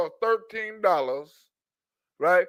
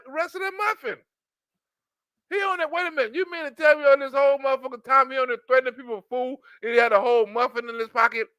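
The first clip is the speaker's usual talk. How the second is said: ordinary delivery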